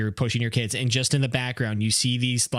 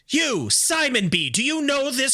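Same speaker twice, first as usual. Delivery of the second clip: accusing bee; 9 semitones higher than usual. Pretend. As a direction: drag it out and point the finger. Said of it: "They're like".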